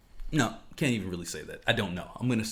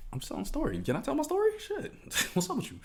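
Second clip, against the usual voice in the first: mock squeaky mom; 5 dB quieter, faster than usual. Mom's reply voiced, pitch jumps.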